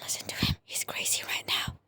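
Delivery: secretive whisper. Acted. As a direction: whisper it so he can't hear. Secret aside about Shrimpo.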